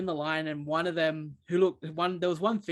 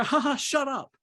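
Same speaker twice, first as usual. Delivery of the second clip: mock "Ha ha" much higher, then a jab; hammed-up fake laughter at her; 9 semitones higher than usual. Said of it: "fake laughter and go".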